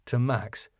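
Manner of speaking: ordinary talk, nothing marked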